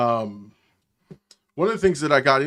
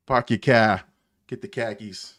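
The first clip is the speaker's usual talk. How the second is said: mock Boston accent, dropped R's. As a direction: toss out a token bit of Boston. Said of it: "that's as much Boston as I know".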